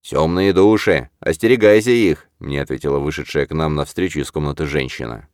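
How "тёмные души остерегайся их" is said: stern warning. A narrator's reading. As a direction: warn them gravely. Warning about dark souls.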